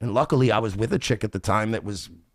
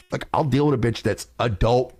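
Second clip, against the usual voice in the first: usual speaking voice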